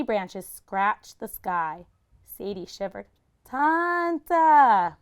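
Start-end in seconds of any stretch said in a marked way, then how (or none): 3.4-5.0 high and loud, calling far. Acted. Sadie calling for the old woman in the woods. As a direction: call into the forest. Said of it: "she called again".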